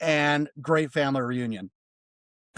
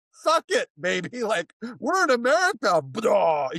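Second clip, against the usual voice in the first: cocky taunt; 7 semitones higher than usual. Retold or imitated. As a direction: rub it in, playfully defiant.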